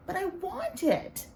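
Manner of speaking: put-on high, slow whine of a child; screeching whiny kid. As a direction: screech it like a spoiled boy. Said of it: "Sammy screeched".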